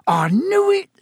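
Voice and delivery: very high, loud triumphant squeal; gleeful shout. A narrator's reading. Stan realises who Harry is, shrieking with glee. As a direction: crow with delight. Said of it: "Stan shouted gleefully".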